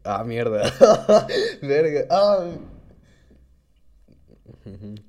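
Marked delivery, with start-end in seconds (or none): 0.7-1.6 risa aguda repentina; high burst of laughter. Real, not acted. Risa tras decir groserías. (carcajada aguda)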